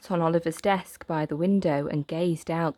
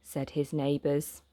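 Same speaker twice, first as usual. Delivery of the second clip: usual speaking voice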